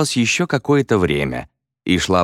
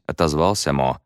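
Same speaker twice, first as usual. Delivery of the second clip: plain reading voice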